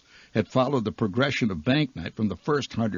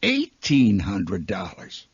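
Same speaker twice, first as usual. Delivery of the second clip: slow, lower-pitched reveal of the sum; hushed awe; 3 semitones lower, slower than usual. Acted. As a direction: let the bigger figure land slowly. Next week's even bigger jackpot.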